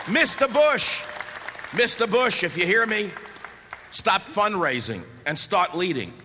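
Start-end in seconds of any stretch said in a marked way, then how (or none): 0.1-2.4 calling out. Real, not acted. Calling out to the president.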